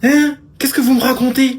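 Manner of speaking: changed voice. Acted. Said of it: "tu changes autant de voix que de culotte".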